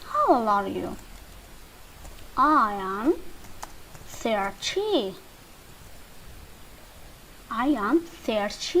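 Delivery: normal talking voice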